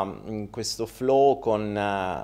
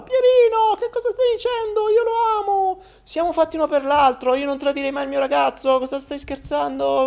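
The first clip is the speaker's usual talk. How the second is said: indignant falsetto sister; 3 dB louder than usual. Acted.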